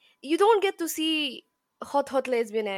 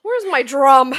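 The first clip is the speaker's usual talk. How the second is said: playful loud call; 12 dB louder, slower than usual. Pretend. Playful exclamation.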